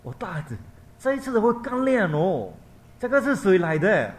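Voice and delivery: high, loud wondering question; mother asking her son who came; amazed mother. Acted. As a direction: marvel at the brighter light. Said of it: "他是问他的儿子".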